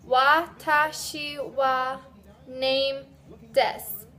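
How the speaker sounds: slow, careful model sentence; teacher leading a class drill; slow repeat-after-me. Shown as a demonstration. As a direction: say it slowly so they can follow. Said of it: "let's try it together".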